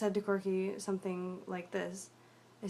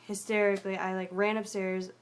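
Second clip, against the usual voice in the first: usual speaking voice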